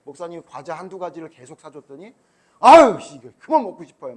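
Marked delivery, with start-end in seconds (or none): 2.6-4.1 imitating a fed-up kid, louder; mock annoyed child whining; kids complaining. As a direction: groan like a kid sick of snacks. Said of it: "막 이렇게 얘기하잖아".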